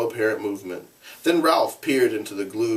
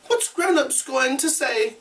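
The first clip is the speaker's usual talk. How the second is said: much higher worried cry. Acted. Piggy crying out to Ralph, high and worried. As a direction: cry it out in dismay. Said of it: "cried Piggy again".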